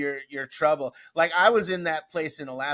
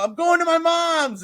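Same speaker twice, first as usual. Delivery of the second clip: high, loud, stretched holler; yelling back; 14 semitones higher, 8 dB louder than usual. Retold or imitated. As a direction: imitate a friend shouting back on the run. Runner yelling back to car.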